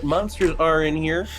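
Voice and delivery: ordinary talk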